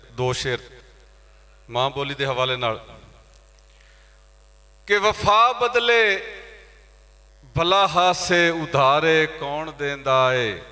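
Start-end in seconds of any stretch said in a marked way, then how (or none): 4.9-10.6 ghazal recitation. Acted. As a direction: declaim the opening couplet, stretching the words. Opens a ghazal. (ਗਜ਼ਲ ਦਾ ਸ਼ੇਰ ਲਮਕਾ ਕੇ ਪੜ੍ਹਨਾ)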